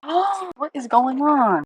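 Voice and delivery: loud, frustrated disbelief; annoyed. Real, not acted. Vlogger watching a print go wrong, annoyed. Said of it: "I'm getting so annoyed".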